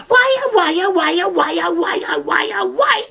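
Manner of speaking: high wailing why oh why; wailing old lady. Acted. Old lady wails at the ogre's thin arms, high mock lament. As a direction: wail it high in mock dismay.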